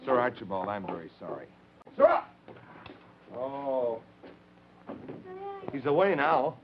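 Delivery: normal talking voice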